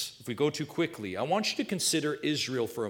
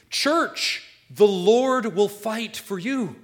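loud, raised, emphatic proclamation; full-force preaching; 4 semitones higher, 6 dB louder, slower than usual. Real, not acted. Preacher declares the promise to Israel, pastor and church, full-force preaching. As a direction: drive the promise home to each listener.

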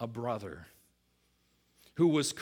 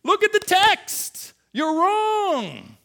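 much higher, louder, slow scolding; scolding rebuke; 13 dB louder, much slower than usual. Retold or imitated. Preacher imitating Nathan scolding David, shouting high and loud. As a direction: wag a finger and yell the rebuke. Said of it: "He doesn't come to David and say".

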